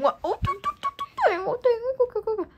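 imitated music beat; beatboxing.

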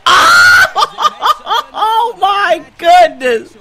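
laughing through the words, slow and high; cracking up in disbelief. Real, not acted. Gamer reacts to a great play.

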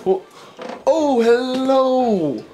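drawn-out, high theatrical hello; mock-surprised greeting. Host acts surprised to find an audience, theatrical greeting. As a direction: greet them slowly, pitched well up.

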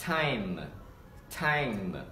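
"time" with long ai, closing on M. Shown as a demonstration. Teacher models final M, slow drawn-out time. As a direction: stretch "time" and finish on the M. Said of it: "âm M vào cuối".

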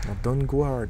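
"Dawnguard" said with a mock English accent. Shown as a demonstration. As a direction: say "Dawnguard" the English way, a bit showy. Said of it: "Avec mon accent absolument irréprochable". (« Dawnguard » avec un accent anglais exagéré)

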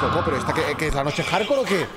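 ordinary talk

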